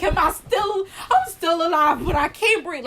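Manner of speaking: high, loud panicked plea; frantic and gasping for air; panicking. Retold or imitated. Patient panics she can't breathe, high and loud.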